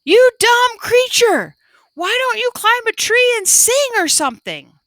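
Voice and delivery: high, loud angry yell; loud shout. A narrator's reading. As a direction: shout at the bird in frustration. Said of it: "Mingling loudly shouted".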